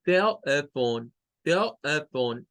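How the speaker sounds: "Telephone" slowly in three syllables, tel stressed; slow teaching demo of "Telephone". Shown as a demonstration. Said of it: "Son tres sílabas".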